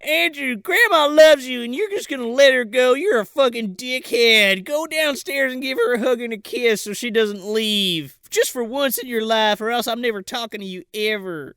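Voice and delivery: high, worked-up mock scolding; scolding sister. Sister orders brother to hug departing grandma, high mock-indignant rant.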